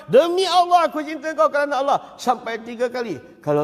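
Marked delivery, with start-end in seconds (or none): 0.1-2.0 louder and higher, declared with feeling; raised, fervent oath. Shown as a demonstration. Preacher repeats the oath louder to the crowd. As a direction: swear it with full conviction. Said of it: "Apa kata kau".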